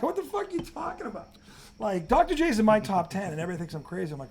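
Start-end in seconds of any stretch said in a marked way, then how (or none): 0.0-1.3 pitched way up in laughing disbelief; exasperated squeal, half laughing; incredulous outburst. Retold or imitated. Retelling his outrage at a friend's basketball take, in laughing disbelief.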